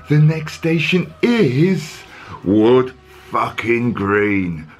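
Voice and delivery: slow, drawn-out posh announcement with a swear; plummy tube voice naming a rough stop; mock posh automated lady; posh announcer voice. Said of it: "Surely she should say".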